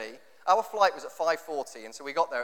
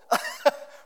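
a sudden high burst of laughter; real high laugh at what he saw. Real, not acted. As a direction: laugh in disbelief. Laughing at people on trip.

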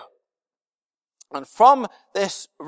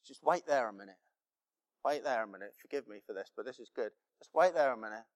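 God's aside; 7 semitones lower, 12 dB quieter, much faster than usual. Pretend. God voiced, imagined scene.

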